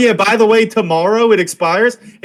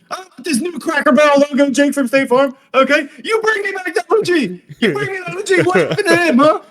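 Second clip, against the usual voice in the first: high-pitched indignant rant; mock outrage; 9 semitones higher than usual. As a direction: demand it back in a hammed-up high voice. Mock outraged rant about new Jake.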